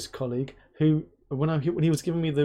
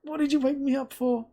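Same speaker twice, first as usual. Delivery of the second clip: groggy annoyed wife; 11 semitones higher than usual. Retold or imitated. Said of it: "And she's gonna be like".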